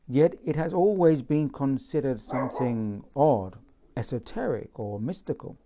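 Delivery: measured, dramatic reading of a prologue; story narration. Acted. Author reading his new book's prologue to viewers, solemn narration. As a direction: read it like the opening of an epic tale.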